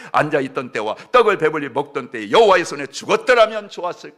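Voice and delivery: loud, high sermon storytelling; full-force preaching. Acted. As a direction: thunder the story of Israel to the church. Preacher retelling Israel's complaint. (힘차게 외치는 설교)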